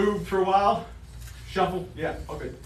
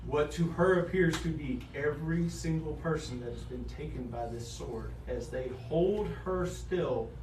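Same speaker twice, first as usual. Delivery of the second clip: game master's narration; 4 semitones lower, 7 dB quieter than usual. Acted. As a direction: narrate the kill quietly and deliberately.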